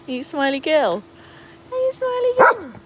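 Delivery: sing-song praise for the dog; cooing pet talk. Real, not acted. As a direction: coo at the smiling dog.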